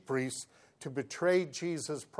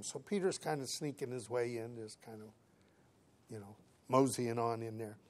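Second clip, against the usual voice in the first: softer, lower aside trailing almost away; hushed sneaky aside; 4 semitones lower, 11 dB quieter than usual. Retold or imitated. Preacher describing Peter sneaking his way in, hushed. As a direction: drop the volume as if sneaking in.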